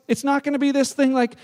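normal talking voice

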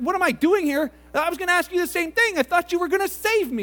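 high-pitched, incredulous comeback; indignant protest. Acted. Man challenging God in heaven, indignant protest. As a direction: throw the question back, acted indignation.

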